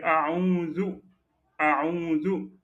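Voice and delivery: أعوذ with the full au vowel, rising; correct أعوذ. Shown as a demonstration. Teacher correcting the student's vowel, spoken with a rise. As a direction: stretch the first vowel of أعوذ. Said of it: "Donc vous allez dire".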